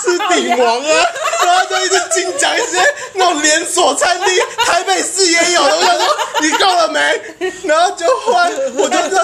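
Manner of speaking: high, squealing talk through laughter; laughing, exasperated high-pitched rant. Real, not acted. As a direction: crack up in disbelief while talking. Cracking up retelling driver's suggestions.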